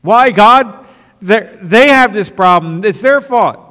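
loud, high, indignant complaint; high loud self-righteous protest. Retold or imitated. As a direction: whine your blame at God. Arguing back at God.

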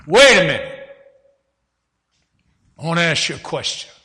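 loud, sharp Wait a minute; commanding shout. Retold or imitated. Preacher cutting off the cursing old man, loud command. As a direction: stop him before he can cuss.

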